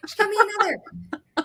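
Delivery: high excited squeal. Real, not acted. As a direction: squeal it with delight. Excited at the giveaway.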